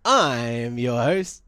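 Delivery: slow deadpan. Acted. Sabotaging intro slowly.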